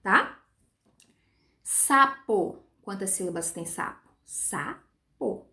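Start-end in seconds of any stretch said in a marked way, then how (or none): 1.6-2.6 "Sapo" split slowly as sa, po; two separate beats on "Sapo", sa then po; syllable by syllable. Shown as a demonstration. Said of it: "Duas sílabas". 4.2-5.5 "Sapo" softly split as sa, pause, po; softer two-beat "Sapo" with a gap; syllable by syllable. Shown as a demonstration. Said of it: "Duas sílabas".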